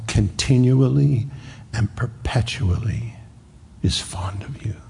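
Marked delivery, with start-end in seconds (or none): 3.8-4.8 soft unvoiced whisper; whispered, intimate and sincere; tender whisper. Real, not acted. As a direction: breathe it out tenderly. Preacher whispers tender phrase.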